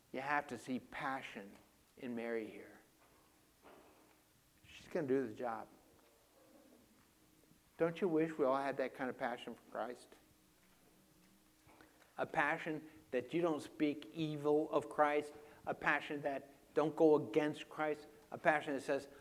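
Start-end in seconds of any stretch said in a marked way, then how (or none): none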